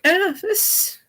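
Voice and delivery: high squeaky unimpressed voice. Retold or imitated. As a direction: squeak out a lukewarm verdict. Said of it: "I was like".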